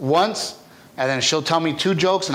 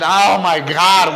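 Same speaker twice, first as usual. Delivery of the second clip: slow, loud, higher alarmed mom; 4 semitones higher, 9 dB louder, much slower than usual. Retold or imitated. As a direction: play the shocked mother stepping outside. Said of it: "my mom would have come outside".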